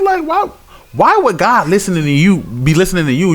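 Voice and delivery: incredulous preacher questions; fired-up street sermon; heated preaching. Acted.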